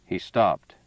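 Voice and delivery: ordinary delivery, nothing marked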